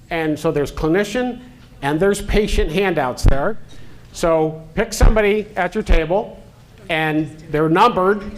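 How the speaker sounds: ordinary talk, nothing marked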